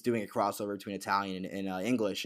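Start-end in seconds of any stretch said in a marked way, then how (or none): none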